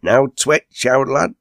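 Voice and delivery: high-pitched hearty call to a mate; crying out. A narrator's reading. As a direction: egg him on with a lifted voice. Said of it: "cried Enoch".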